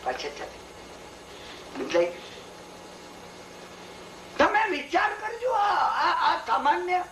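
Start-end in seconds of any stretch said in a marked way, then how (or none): 4.4-7.0 much higher, choked with feeling; moved, voice breaking. Real, not acted. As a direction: let emotion crack through as you urge them. Emotional turn to moral.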